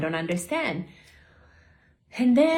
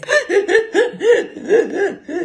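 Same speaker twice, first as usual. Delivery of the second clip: a sudden high loud laugh; loud burst of laughter; 12 semitones higher, 6 dB louder than usual. Real, not acted. Laughs recalling crying on flight.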